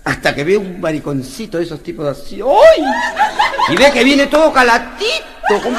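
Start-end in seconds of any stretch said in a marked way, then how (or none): 2.4-5.8 shrill high loud yelps; hammed-up camp squeal; loud high camp shriek; camp mimicry. Mocking effeminate guest.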